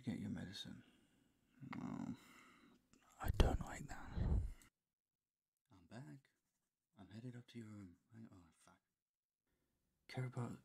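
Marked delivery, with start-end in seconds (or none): 1.8-6.2 whisper. Acted. Whispered voiceover takes.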